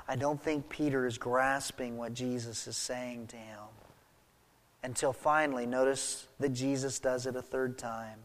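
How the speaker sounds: ordinary talk, nothing marked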